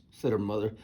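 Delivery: ordinary delivery, nothing marked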